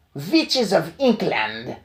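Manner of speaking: slow, drawn-out witch call with a German v; witch's shout. Acted. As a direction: summon the witches to attention. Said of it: "shouted the Grand High Witch".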